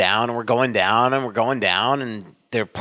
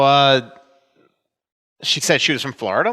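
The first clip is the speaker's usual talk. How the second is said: ordinary delivery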